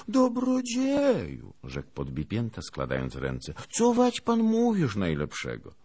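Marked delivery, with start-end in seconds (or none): 0.1-1.5 very high, drawn-out plea; appalled Lithuanian. A narrator's reading. Lithuanian clasps his hands at the teasing, appalled. 3.7-5.7 high, loud start to a dismayed question; appalled Lithuanian. A narrator's reading. Lithuanian begs the officer to explain, appalled.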